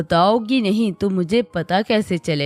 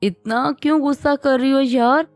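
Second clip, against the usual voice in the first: higher, fake-frightened plea; hammed-up scared husband; mock fear; 6 semitones higher than usual. A narrator's reading. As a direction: pretend to be scared of her. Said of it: "डरने की acting करने लगा".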